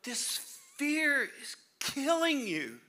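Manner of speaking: high slow urgent Lord; the Lord pleading. Pretend. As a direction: plead slowly, gripping their face. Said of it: "The Lord is trying to grab you".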